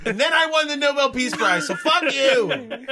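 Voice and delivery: high, loud hammed-up tirade; mock angry boast. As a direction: crow it defiantly as the rant's punchline. Climax of pretend scientist rant.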